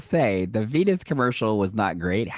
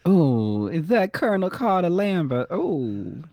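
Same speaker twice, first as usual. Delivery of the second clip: higher, swooning Southern lady; Southern accent; 7 semitones higher, slower than usual. Acted. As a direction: play a Southern belle greeting a colonel. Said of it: "Say it with a Southern accent".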